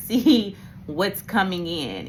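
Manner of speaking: usual speaking voice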